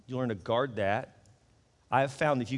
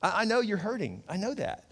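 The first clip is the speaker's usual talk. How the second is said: higher, gentle reassurance; God comforting; 7 semitones higher than usual. Acted. God speaking to a hurting congregation, gentle higher reassurance. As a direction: soothe a hurting listener as God. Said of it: "God's saying to you today".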